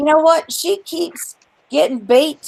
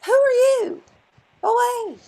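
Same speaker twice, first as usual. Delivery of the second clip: high, scornful brush-off; incredulous high dismissal; dismissive retort; 10 semitones higher than usual. Retold or imitated. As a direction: shoo her away, pitched up. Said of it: "I'm like".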